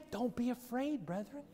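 sudden hush after the shouting; soft reassurance. Acted. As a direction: pull back and comfort them quietly. Preacher drops to gentle reassurance.

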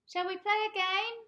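put-on very high, loud child asking; little boy voice. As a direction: ask eagerly like a small boy. Little boy asks mum.

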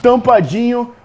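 normal talking voice

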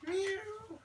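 high, soft kitty mew; cat meow. Pretend. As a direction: mew softly like a little cat. Cat sound repeated for child.

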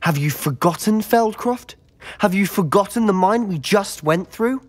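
high-pitched, indignant questioning; heated challenge. Acted. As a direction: cut in and throw it back accusingly. Acted quarrel about goblins.

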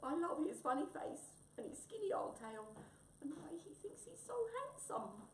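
high, tearful fondness; gulping wombat. Acted. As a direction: confess affection while choking up. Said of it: "gulped Wombat".

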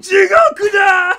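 high, loud, slow dramatic yell in Japanese; over-the-top Japanese battle cry; hammed-up anime shout. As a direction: scream it like an anime villain's doom.

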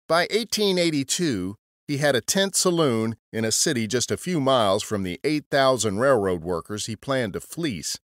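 ordinary delivery

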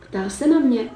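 ordinary delivery, nothing marked

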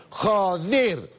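"Joder" high and drawn out on the last syllable; emphatic JODER. Shown as a demonstration. Comic capping a denial with a swearword, emphatic long "Joder". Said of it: "si además remata la frase con".

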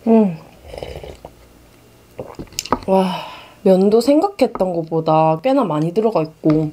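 chewing between words; eating while talking; mouth full of noodles. Real, not acted. Seen 1: Chews noodles early, takes another bite at 2.8, then speaks clearly.

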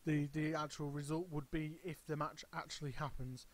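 normal talking voice

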